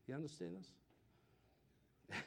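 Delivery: breathy unvoiced whisper, slow; hushed slow whisper. Real, not acted. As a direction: lean in and whisper the question. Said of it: "whispered aside to congregation".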